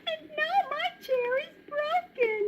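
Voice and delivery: high little cub voice; baby bear. Acted. Baby bear finding his broken chair, tiny voice. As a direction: squeak it out like a dismayed cub.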